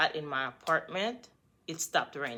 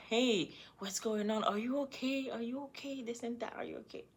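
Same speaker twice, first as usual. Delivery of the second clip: high, fading, clingy voice for the other woman; mock worried girl; 7 semitones higher, 6 dB quieter than usual. The other girl's repeated Messenger texts, high and fading. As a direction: read her fretting, higher and trailing softer.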